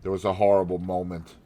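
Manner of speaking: ordinary delivery, nothing marked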